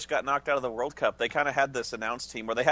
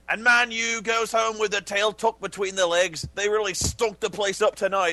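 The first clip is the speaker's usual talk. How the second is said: higher, slow plummy British pundit voice; mock British commentator; 5 semitones higher, slower than usual. Friend mocking a British pundit on a Man U loss, higher and slow. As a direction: lay on a posh British commentator, slowly. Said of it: "they'll be like".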